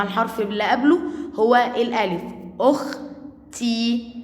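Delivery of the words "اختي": اختي very slowly, kh joined to the first vowel, then a pause; syllable split. Shown as a demonstration. Teacher showing sukun joins the previous letter, slow split. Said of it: "هيروح مع الحرف اللي قبله".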